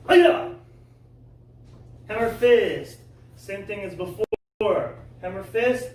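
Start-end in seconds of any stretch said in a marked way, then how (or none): 0.1-0.5 very loud piercing high yell; kiai shout. Shown as a demonstration. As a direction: explode the yell like breaking a board. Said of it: "funny stance".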